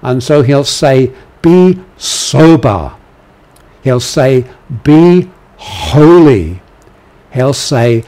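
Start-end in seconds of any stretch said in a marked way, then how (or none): 1.4-2.9 slow, higher, louder command. Shown as a demonstration. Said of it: "I'll kind of speak them, uh, in the kind of, uh, imperative, um, voice". 4.8-6.6 slow, loud ringing command. Shown as a demonstration. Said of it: "imperative, um, voice".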